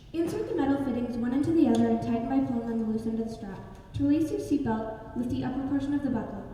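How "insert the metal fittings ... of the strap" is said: ordinary talk, nothing marked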